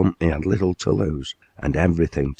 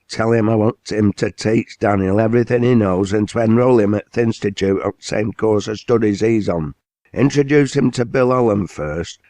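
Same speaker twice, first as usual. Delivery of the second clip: commanding mill owner; 4 semitones higher than usual. Acted. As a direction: lay down the law to the works manager. Owner instructs Ben.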